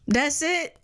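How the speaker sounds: high, offhand "That's it"; the godmother shrugging off the news, high and casual; unimpressed shrug. Retold or imitated. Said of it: "she gave me this look, like".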